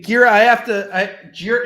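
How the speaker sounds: normal talking voice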